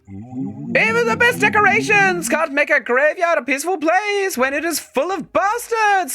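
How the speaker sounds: high, slow, drawn-out spectral voice; ghost voice. Acted. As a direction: intone it like a solemn ghost. Keeps ghost voice reading dialogue.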